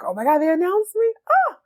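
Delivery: high, gasping mock delight; excited. Model hearing there was an announcement, excited. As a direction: imitate her bursting with excitement. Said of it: "She's so excited".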